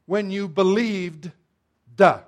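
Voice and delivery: BELIEVED slowed, with a separate hard "Duh" on the end; past-tense ending exaggerated. Shown as a demonstration. Preacher drilling past tense into the congregation, "believed" ending in an exaggerated "Duh". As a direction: punch out the final d of "believed" as its own syllable, "Duh".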